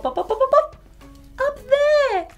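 ordinary delivery, nothing marked

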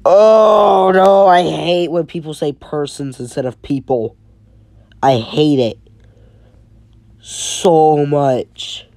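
loud, high exasperated complaint; annoyed outburst. Real, not acted. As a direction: groan it out in real irritation. Annoyed rant about wording.

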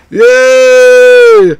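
loud high cheer; excited yay. Real, not acted. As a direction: shout your joy. Cheering lead in game.

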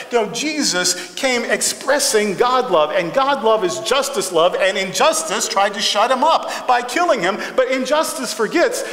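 ordinary talk

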